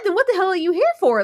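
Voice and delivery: normal talking voice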